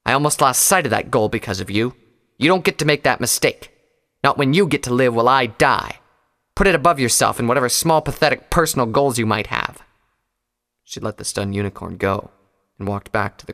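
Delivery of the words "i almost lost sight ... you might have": louder fierce rebuke; angry. A narrator's reading. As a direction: press her against the bars. Pyre angry.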